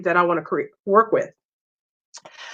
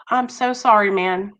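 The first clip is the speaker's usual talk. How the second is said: ordinary delivery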